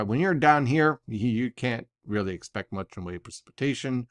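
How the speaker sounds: usual speaking voice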